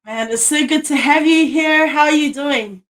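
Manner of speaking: usual speaking voice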